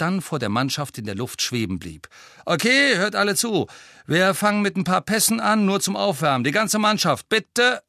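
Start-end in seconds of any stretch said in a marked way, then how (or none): 2.4-7.8 high, loud captain's voice; rally the whole team; calling out orders. A narrator's reading. Angelina briefs her team in the air, calling out orders.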